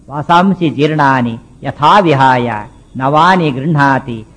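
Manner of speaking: slow, measured Sanskrit recitation; verse recitation. Acted. Preacher quoting the Gita to listeners, slow verse recitation. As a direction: recite it slowly, drawing out each word.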